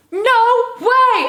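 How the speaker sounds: high-pitched, stretched astonishment; imitating amazed disbelief. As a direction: mimic a starstruck gasp of recognition. Fan's amazement retold.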